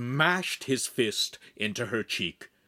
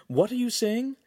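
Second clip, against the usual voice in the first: high, baffled query; alarmed question; 13 semitones higher than usual. A narrator's reading. Friend trying to understand babble, high alarmed question. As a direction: ask in pitched-up confusion.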